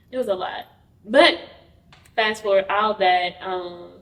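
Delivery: ordinary talk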